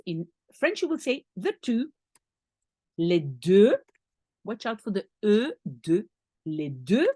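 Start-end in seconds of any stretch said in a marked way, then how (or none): none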